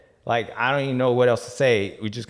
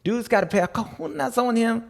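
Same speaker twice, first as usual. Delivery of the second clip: mock voice; 9 semitones higher than usual. Retelling a comment in a voice.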